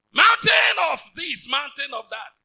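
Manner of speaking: slow roaring shout, high and loud; preacher shouting down the mountain; shouted command. Acted. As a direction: hurl it at the mountain.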